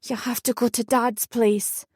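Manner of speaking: usual speaking voice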